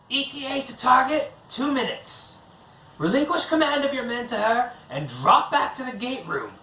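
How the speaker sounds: high, commanding alien voice issuing orders; crisp, urgent commands from a high-voiced handler; Giseldra giving orders. A narrator's reading. Giseldra orders Ganon through the firefight, high and commanding. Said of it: "Giseldra hissed into Ganon's ear".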